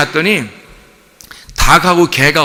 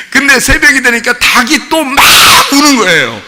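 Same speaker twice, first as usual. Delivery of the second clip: raised voice; 6 semitones higher, 4 dB louder than usual. Real, not acted. Preaching climax about howling animals.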